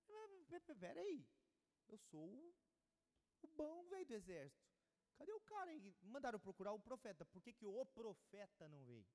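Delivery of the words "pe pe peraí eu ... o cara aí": softly voiced, stammering mock affront; jumpy, put-out protest with swings into high pitch; affronted big shot. Naamã to the servant sent to the door, affronted. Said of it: "Como você acha que esse cara ficou?".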